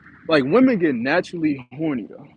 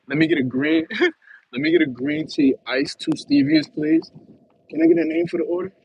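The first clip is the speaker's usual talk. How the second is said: higher, lively order voice as a skit; mock coffee order; 11 semitones higher than usual. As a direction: act out ordering green tea with stevias. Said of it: "you gotta say it just like that".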